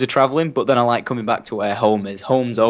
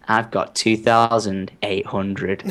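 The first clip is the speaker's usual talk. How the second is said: slow, drawn-out mock-smug dad; dead smug; 3 semitones lower, much slower than usual. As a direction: imitate dad savouring his win, very slowly. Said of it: "dead smug".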